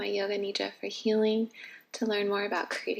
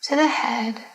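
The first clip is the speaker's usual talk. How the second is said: guided meditation; 6 dB louder than usual. Acted. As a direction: lead them gently into relaxation, phrase by phrase. Leads a yoga nidra.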